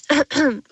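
throat clear mimicking a chatbot; little put-on ahem; imitated throat-clearing. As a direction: demonstrate the bot clearing its throat. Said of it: "dann hat er manchmal auch so".

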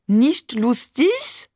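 high, slow mock French accent, rising question; teasing, drawn-out French-accented echo; exaggerated French accent. A narrator's reading. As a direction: mimic someone with an overdone French accent. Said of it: "ahmte Barbe übertrieben Mamans französischen Akzent nach".

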